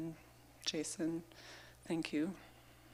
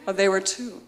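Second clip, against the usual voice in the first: laughing; 4 semitones higher, 11 dB louder than usual. Real, not acted. Laughing correction after being corrected.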